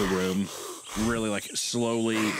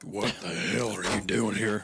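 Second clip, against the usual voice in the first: slower, gruff dad voice; angry dad; slower than usual. Retold or imitated. Construction-worker dad finding his kid on the floor, slow gruff voice. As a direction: growl it as the annoyed dad. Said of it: "He'd be like".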